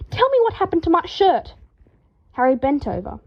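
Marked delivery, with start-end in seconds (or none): 0.1-1.5 high, loud demanding shout; high, loud insistent shout. A narrator's reading. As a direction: demand an answer from Harry. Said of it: "Draco shouted".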